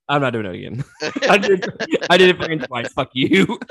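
loud, laughing protest; embarrassed, laughing loudly at friend; laughing refusal. Real, not acted. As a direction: refuse while cracking up.

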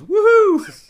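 imitated whooping shout; comic screamed "woohoo"; high loud woohoo. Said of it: "the chef pops it and just goes".

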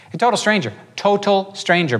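high, insistent "total stranger"; emphatic aside. Real, not acted. Storyteller stresses a detail in an aside.

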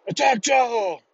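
high, strained barked command; drill instructor. Retold or imitated. Drill instructor orders recruits into the chow hall, barked. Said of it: "drill instructor says".

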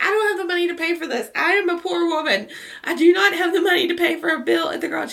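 high-pitched, rushed mock panic; retold freak-out. Driver with no money for the garage, freaking out. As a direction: plead frantically, fast and high. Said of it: "I start freaking out again".

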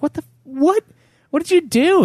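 high, shocked disbelief. Retold or imitated. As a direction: yelp it out in disbelief. Shocked at broken TV.

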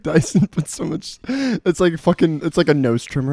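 ordinary talk, nothing marked

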